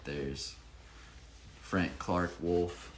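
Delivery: plain reading voice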